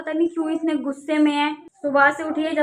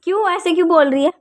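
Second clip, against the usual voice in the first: louder, higher sharp telling-off; scolding; 5 semitones higher, 8 dB louder than usual. Real, not acted. Mother scolds hitting child. (ऊँची आवाज़ में डाँट)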